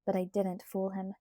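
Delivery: usual speaking voice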